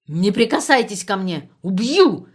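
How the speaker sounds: wild unhinged ranting; hysterical shouting. Acted. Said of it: "истерически кричал Ландхарт". (истерический крик)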